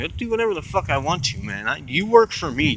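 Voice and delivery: high-pitched, acted-out defiance; indignant comeback climbing into a squeak; mock indignant retort. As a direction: retell talking back, voice leaping high. Retold defiant retort to police.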